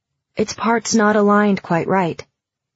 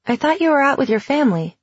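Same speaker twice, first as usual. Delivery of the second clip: high, quick surprised question; 7 semitones higher, faster than usual. A narrator's reading. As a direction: blurt it out, caught off guard.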